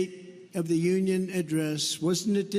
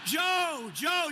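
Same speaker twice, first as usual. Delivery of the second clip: higher-pitched mock aide interjecting; correcting aide; 7 semitones higher than usual. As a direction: play an aide urgently correcting the boss. Acted aides correcting the confused candidate.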